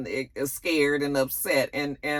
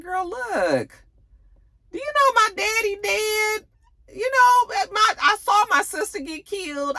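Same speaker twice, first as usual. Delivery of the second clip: much higher mocking voice; mock Tariq; 11 semitones higher, 3 dB louder than usual. Tariq brushing off death, high mock voice. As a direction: ham up the callous boyfriend, high.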